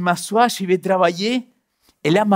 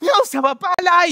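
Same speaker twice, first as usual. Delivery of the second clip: annoyed retort; 11 semitones higher than usual. Retold or imitated. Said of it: "Et je lui ai dit".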